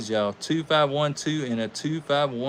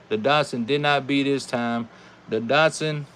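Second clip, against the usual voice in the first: usual speaking voice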